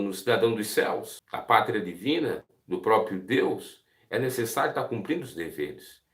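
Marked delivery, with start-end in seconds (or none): none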